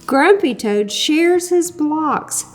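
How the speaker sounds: usual speaking voice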